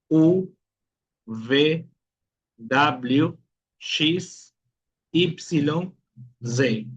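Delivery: letter names recited in Portuguese, each set apart by a pause; teacher models the Portuguese alphabet letter by letter. Shown as a demonstration. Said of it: "nós temos o que seria o alfabeto em português".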